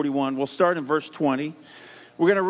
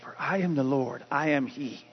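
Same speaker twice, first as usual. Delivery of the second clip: much softer, lower, hushed reply; soft answer; 5 semitones lower, 6 dB quieter than usual. Acted. Pastor voicing God's reply to why, hushed and soft. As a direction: answer the cry gently, almost under the breath. God answers 'why did this happen'.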